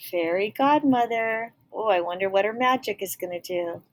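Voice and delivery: ordinary delivery, nothing marked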